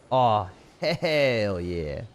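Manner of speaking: HELL stretched very slowly; drawn-out cheer. Acted. Streamer savours his own catchphrase, drawing it out. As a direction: draw "hell yeah" out long.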